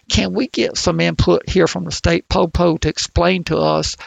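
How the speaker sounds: a rough imitation of an old West Virginia coal miner; West Virginia accent. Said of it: "I apologize for my horrible accent".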